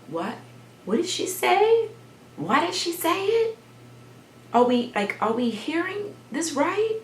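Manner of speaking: high, baffled questioning; imitated confusion. Children asking each other what she said, imitated confusion. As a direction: ask in high-pitched disbelief, as confused children. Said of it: "we were confused, like".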